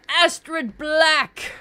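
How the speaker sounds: gasp, then a high, slow, delighted call of the name; gasping surprise. Real, not acted. Streamer spotting her own drawing in the list, gasping with delight. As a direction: recognise it with a joyful start.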